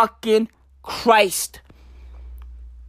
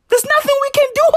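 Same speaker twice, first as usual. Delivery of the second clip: fast high squeak; much faster than usual. Acted. Vlogger to fans, a fast high squeak. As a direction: rattle it off fast and much higher. Rushed high resigned aside.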